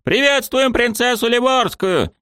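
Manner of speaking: crowd shout. Acted. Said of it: "дружно прокричали".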